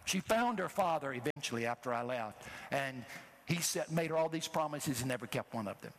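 ordinary delivery

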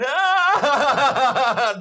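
loud, high anguished crying wail; weeping cry out. Retold or imitated. Man bent over in the aisle cries out in a loud high wail. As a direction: let out the wail of a man overcome. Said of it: "just like that".

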